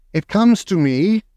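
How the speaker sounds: a lightly voiced witch; grand witch speaking. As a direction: announce it grandly. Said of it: "said the Grand High Witch".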